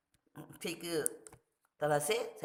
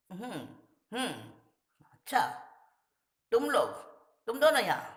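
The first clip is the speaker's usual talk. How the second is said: startled alarm; 7 semitones higher, 5 dB louder than usual. Acted. The boys and uncle in alarm.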